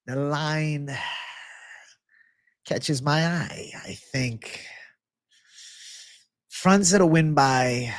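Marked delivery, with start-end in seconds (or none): 3.8-4.6 unvoiced, confiding whisper. Real, not acted. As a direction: let the pick slip out in a hush. Secret pick.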